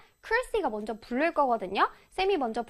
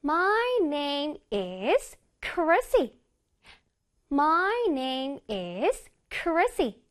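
"My name is Chrissy" stretched note by note; very slow, melodic "My name is Chrissy"; piano-like intonation; much slower than usual. Shown as a demonstration. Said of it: "내 몸이 피아노가 된다고 생각해야 돼".

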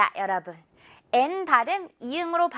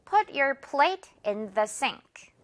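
one more slow model; slow drill; much slower than usual. Shown as a demonstration. Teacher models again, very slow. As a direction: Put your plate in the sink said very slowly. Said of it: "한 번 더요".

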